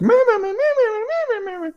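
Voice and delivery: squeaky, very high-pitched babble; Ashley. Retold or imitated. Co-host mimics the game companion's constant exclaiming, very high-pitched. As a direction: rattle off high little nagging squeals, fading. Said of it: "had this really high-pitched voice".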